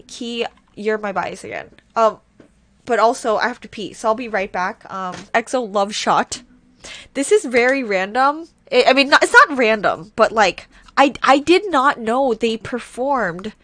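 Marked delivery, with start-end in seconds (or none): none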